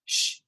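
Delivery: soft, drawn-out shush. Real, not acted. Speaker hushing background noise mid-call, soft long shush. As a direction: hush someone with a long shh.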